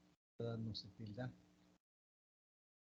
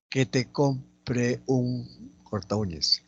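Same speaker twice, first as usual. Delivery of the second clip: slow, word-by-word reading; teacher spacing out each word; slow dictation; 2 semitones higher, much slower than usual. Shown as a demonstration. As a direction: dictate at half pace with gaps. Dictating the exercise sentence.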